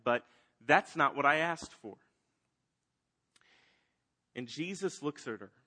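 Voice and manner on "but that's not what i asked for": loud protest, acted out in retelling; angry. Martha objecting to Jesus's promise about her brother, angry. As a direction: push back as the dissatisfied sister, voice jumping louder. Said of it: "she's actually angry with Jesus".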